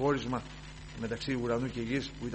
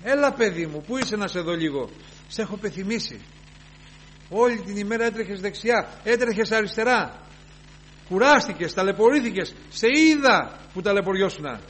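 raised, tender-pleading voice of the Lord; 7 semitones higher, 7 dB louder than usual. Retold or imitated. The Lord calling his weary child, raised loving call. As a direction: plead with a tired child to come home. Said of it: "λέγει ο Κύριος".

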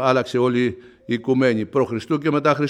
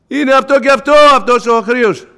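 high, loud denunciation; queen names the enemy, loud and high; accusing queen; 12 semitones higher, 9 dB louder than usual. Acted. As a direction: point him out before the king. Esther denounces Haman to king.

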